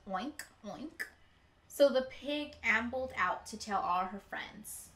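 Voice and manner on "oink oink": soft grunted oink, oink; pig oinking. Acted. Librarian hears only the pig's soft oinks. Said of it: "all the librarian heard was".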